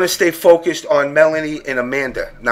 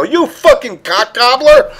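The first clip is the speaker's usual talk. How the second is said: mock insult; 9 semitones higher, 4 dB louder than usual. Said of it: "go up to her in the middle of a match and go".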